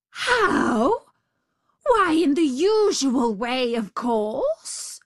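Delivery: high, loud incredulous outburst; exasperated tiger. A narrator's reading. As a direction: explode at the stupid question. Tiger exasperated by jackal's questions.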